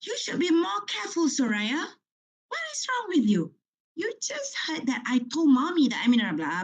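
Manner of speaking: high, sharp mock scolding of a child; father scolding. Rushed father scolds his daughter over spilled coffee, high and sharp. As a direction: snap at the little girl like a rushed dad. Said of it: "he started screaming at his daughter".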